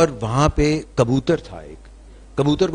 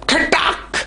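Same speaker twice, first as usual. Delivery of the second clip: snap sound; much slower than usual. Retold or imitated. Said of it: "کی آواز آئی".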